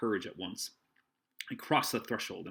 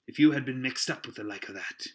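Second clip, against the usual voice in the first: gruff sailor voice; 4 semitones higher, 7 dB louder than usual. Acted. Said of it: "exclaimed the landlord".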